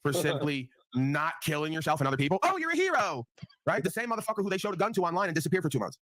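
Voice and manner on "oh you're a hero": high sing-song mock of a fan gushing; mocking praise. Mocking fans who call him a hero, high and gushing. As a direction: gush in a high voice, making fun of it.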